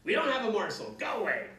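loud holler; witch hollering. A narrator's reading. Witch yelling at the children to leave. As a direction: yell them away from the house. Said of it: "the witch hollered at them".

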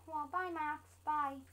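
ordinary talk

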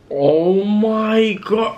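a long drawn-out wail of exasperation; drawn-out exasperated oh my God. Real, not acted. Groaning at the busy week.

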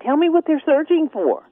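usual speaking voice

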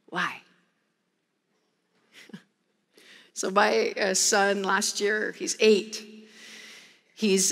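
ordinary talk, nothing marked

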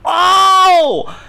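loud, high delighted gasp of oh; sudden loud high Oh; surprised oh. Real, not acted. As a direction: react in shock at the sight. Sees the plated food.

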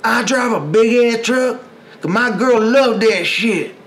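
high, loud, slow mock Republican accent; exaggerated mock Republican voice, slow and high. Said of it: "I was about to do a, a Republican accent".

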